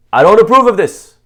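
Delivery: a mock parent's loud public condemnation; loud disapproval. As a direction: proclaim your disapproval for all to hear. Parent loudly showing disapproval.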